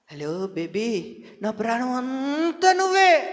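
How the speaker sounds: soft, slow, drawn-out "నా ప్రాణం అంతా నువ్వే"; hammed-up lover's sweet talk; mock sweet-talker. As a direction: coo it like a smitten lover, mocking. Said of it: "ఎట్ట మాట్లాడతారో".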